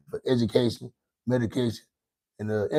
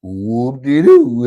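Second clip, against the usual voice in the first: slow, loud mocking whoop-de-doo; loud drawn-out taunt; 10 dB louder, much slower than usual. Real, not acted. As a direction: stretch it out and jeer. Repeating taunt louder.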